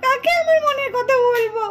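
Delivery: acted crying lament; tearful grief. Young relative mourning her lost confidante, tearful. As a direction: reproach her through tears. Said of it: "রেনুও কাঁদছে।".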